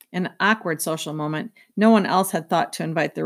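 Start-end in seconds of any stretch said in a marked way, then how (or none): none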